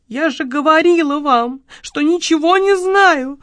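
put-on high, whimpering shop girl; high plaintive sob, slowing at the end; tearful protest. A narrator's reading. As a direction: wail the protest miserably. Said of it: "всхлипнула несчастная продавщица".

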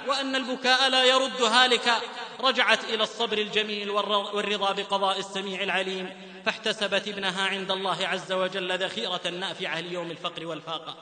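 ordinary delivery

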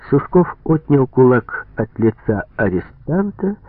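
ordinary delivery

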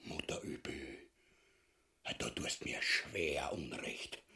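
whispered, low and slow protest; hushed whisper. Acted. Ubu to his wife, whispering in shock at her plan. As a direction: hush it as if someone might hear.

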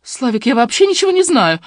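high, whiny protest; pleading whimper. A narrator's reading. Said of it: "захныкала Фоменко".

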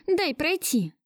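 high, heated demand at ordinary volume; barked demand. A narrator's reading. Woman trying to get past Gleb, mildly barked. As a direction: demand it with a touch of fury. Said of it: "рявкнула я".

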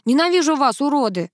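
put-on angry kid's cry, moderately loud; boy's wail. A narrator's reading. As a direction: yell it as a parting insult. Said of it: "умчался с воплем".

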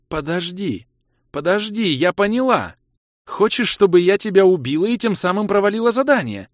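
high, agitated woman's voice; high-pitched heated accusation; seething realization. A narrator's reading. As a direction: build from dawning suspicion to loud anger. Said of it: "прошипела Кира".

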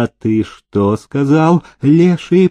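ordinary delivery

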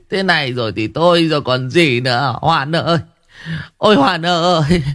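put-on a terrified old man begging the exorcist, rising into a wail; frightened stammer. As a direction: beg for help, terrified and stumbling. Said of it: "sợ quá lắp bắp".

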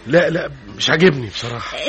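high, loud, flustered protest; agitated, high-pitched no; flustered refusal. Acted.

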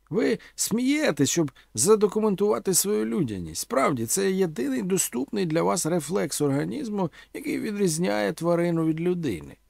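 high-pitched, theatrical oratory; declaiming orator Omela. A narrator's reading. Omela haranguing young listeners with grand questions, declaiming.

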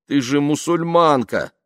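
loud, high, pleading objection; groaning protest. Acted. Man reminds woman of her faith, groaning protest. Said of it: "застонал он".

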